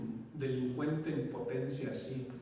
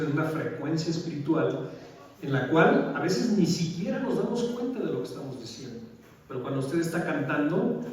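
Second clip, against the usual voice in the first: louder preaching to the brothers; 3 semitones higher, 5 dB louder than usual. Acted. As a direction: preach it with conviction. Preaching to congregation. (predicando con fervor y más fuerte)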